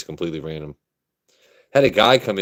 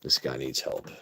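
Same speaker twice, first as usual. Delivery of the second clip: quiet low reread of a chat comment; low muttered reread; 7 semitones lower, 9 dB quieter than usual. Shown as a demonstration. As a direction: lower and softer, half to himself. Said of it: "rereads comment".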